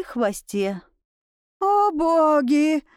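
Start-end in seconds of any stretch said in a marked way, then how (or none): 1.6-2.8 high, drawn-out cry of alarm; exclaimed in shock. A narrator's reading. Tutor facing the werewolf, a drawn-out exclamation. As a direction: stretch it out, aghast and higher. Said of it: "воскликнул гувернёр".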